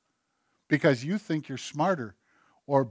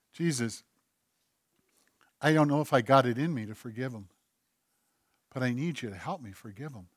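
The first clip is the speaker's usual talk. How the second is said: lower, much softer pleading prayer; quiet prayer; 3 semitones lower, 10 dB quieter than usual. Acted. Believer begging Jesus for help to forgive, hushed. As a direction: murmur it like a private confession.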